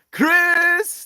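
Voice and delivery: very high, loud, drawn-out name; loud squealed hello to a friend; excited call-out. Real, not acted. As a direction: sing out a delighted greeting. Calls out greeting to arriving viewer.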